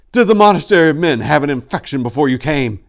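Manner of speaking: fast, loud, breathless question. A narrator's reading. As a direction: ask it out of breath. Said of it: "he breathlessly asked".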